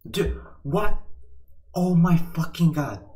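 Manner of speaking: real stunned shock; stunned disbelief. Real, not acted. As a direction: gasp it out in disbelief. Bad pull at the table.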